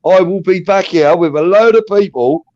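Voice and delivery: high-pitched retold threat; angry threat. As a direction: threaten the burger man, high and heated. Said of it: "I was so angry".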